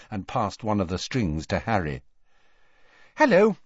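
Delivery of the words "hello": loud, very high chirpy greeting; brightly. A narrator's reading. Hermione greeting the suspicious shopkeeper, brightly. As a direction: sing out a falsely cheery hello. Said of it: "Hermione said brightly to Borgin".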